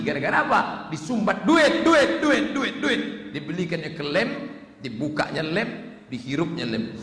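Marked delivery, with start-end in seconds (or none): none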